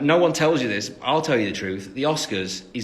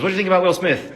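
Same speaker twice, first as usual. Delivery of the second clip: higher, louder presenter's question; quoting the presenter; 6 semitones higher, 6 dB louder, faster than usual. Retold or imitated. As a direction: put the question like a TV host. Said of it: "the very first question the man asked me".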